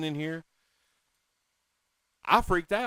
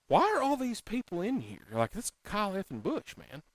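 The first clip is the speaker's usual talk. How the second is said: starstruck; 9 semitones higher than usual. Retold or imitated. Retold starstruck thought.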